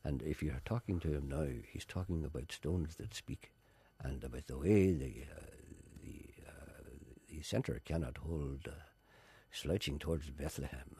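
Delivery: soft, low, mellow poet voice; Seamus Heaney. Retold or imitated. Showing how the poet has mellowed, quiet impression. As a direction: fade into hushed, halting poetic musing. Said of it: "now he talks a little like that".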